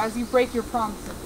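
ordinary talk

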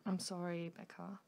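very soft and low, fading on the name; subdued apology. Acted. Apologising to Becca after telling her of the pregnancy, subdued apology. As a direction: apologise gently, almost under the breath.

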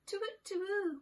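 high hooting owl call; high falling owl hoots. Acted. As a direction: hoot like an owl at night. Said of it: "went the owl".

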